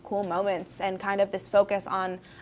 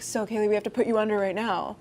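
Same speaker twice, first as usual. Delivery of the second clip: lightly voiced doctor, brisk delivery; quick, businesslike doctor impression; faster than usual. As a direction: put on a hint of a doctor. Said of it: "That's my doctor voice".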